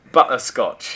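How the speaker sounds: loud botched Australian turning British; teasing, exaggerated British-sounding imitation; mock British accent. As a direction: mimic a bad Aussie attempt that comes out British. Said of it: "You just turn British every time".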